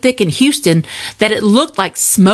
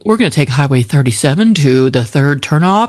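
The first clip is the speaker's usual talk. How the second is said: lightly lowered, calm controller voice; slightly lower, steady radio tone; a hint of pilot voice; 6 semitones lower than usual. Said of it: "he sounds exactly like that".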